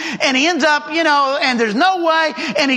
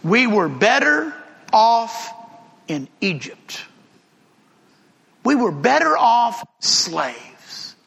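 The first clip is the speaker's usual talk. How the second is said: grumbling Israelites; 2 semitones lower, slower than usual. Retold or imitated. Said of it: "this is gonna be their theme".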